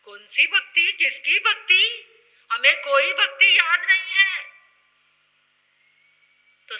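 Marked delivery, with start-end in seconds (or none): 0.0-4.4 high, loud, disbelieving questions; indignant challenge. Acted. Devotees denying they remember any devotion, loud and indignant. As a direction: fire back loudly and high, feigning ignorance.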